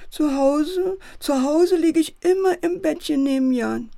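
teddy wailing that he misses Jan; tearful lament. A narrator's reading. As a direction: wail for the lost home. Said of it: "Und er weint schrecklich".

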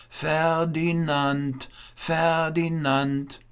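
slow, drawn-out high call. A narrator's reading. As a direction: call the name slowly across a distance. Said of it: "laut einen Namen riefen".

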